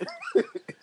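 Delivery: squealing laugh; shrieking with laughter; high burst of laughter. Real, not acted. Big laugh.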